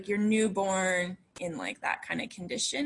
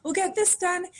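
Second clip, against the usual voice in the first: impatient; 11 semitones higher than usual. Retold or imitated. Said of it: "And, like".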